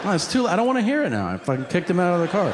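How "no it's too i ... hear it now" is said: rushed, higher, impatient rant; frantic fast brush-off. Retold or imitated. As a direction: hurry to shut him down. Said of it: "And I'm like".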